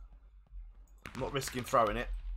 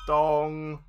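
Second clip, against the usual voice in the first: anvil clang imitation; 3 semitones higher, 6 dB louder than usual. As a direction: ring it out like metal struck. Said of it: "Anvil's here".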